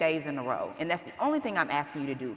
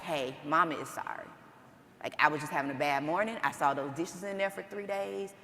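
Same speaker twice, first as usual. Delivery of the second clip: gentle apology; 4 semitones lower, 8 dB quieter than usual. Retold or imitated. Mother apologizing to her daughter.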